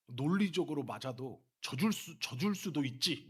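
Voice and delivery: loud, indignant mock complaint; mimicked reproachful tone. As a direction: imitate someone scolding, pushing each phrase. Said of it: "막 이런 투로 얘기하시는 거예요".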